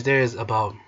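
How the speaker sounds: usual speaking voice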